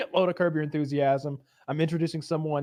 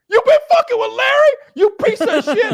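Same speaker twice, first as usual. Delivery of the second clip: very high, loud laughing scream; furious yell; 12 dB louder than usual. Retold or imitated. Leon attacking the bald man, shrieking mock rage. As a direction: explode at him. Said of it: "he's like".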